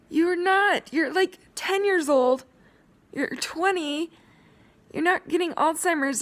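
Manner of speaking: upset pleading. Real, not acted. Vlogger telling strangers to stop mocking, upset and high. Pleading angrily against mockery.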